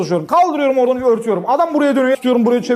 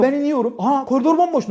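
ordinary delivery

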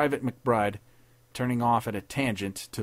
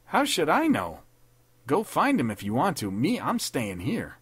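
lightly raised tough private; dismissive soldier; 6 semitones higher than usual. A narrator's reading. Wesson, refusing to look for the captain, dismissive. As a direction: shrug him off.

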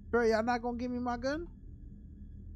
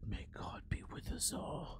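whispered mock echo of newscaster; 13 dB quieter, slower than usual. Real, not acted. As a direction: breathe it back like a prayer. Mock-echoes newscaster's sign-off.

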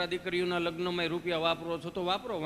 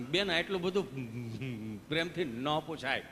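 lower, very slow, dragged-out protest; slow stammering patient; 6 semitones lower, 3 dB quieter, slower than usual. Retold or imitated. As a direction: complain ponderously, word by word. Gabho slowly objects, comic.

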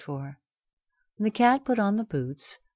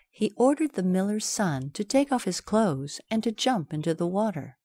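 plain reading voice

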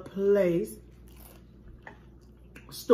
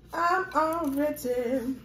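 stretched out; 6 semitones higher, much slower than usual. Real, not acted. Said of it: "I'm already full".

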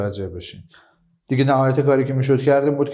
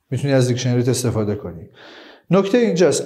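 ordinary talk, nothing marked

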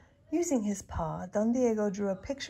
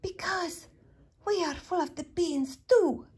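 very high little voice; tiny dog voice; 9 semitones higher than usual. A narrator's reading. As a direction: squeak it out.

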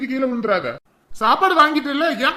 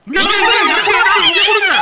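loud, high-pitched demand; clamouring shout; 7 semitones higher, 8 dB louder than usual. Acted. Hungry crowd pushing for food, clamouring loudly. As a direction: shout over a jostling crowd.

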